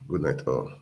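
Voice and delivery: ordinary delivery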